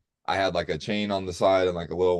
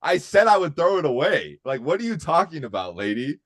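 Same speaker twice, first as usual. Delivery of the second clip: indignant shout; 8 semitones higher, 5 dB louder than usual. Retold or imitated. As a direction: cut in over her radio call in protest. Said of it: "was like".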